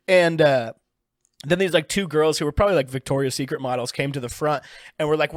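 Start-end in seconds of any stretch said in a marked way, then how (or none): none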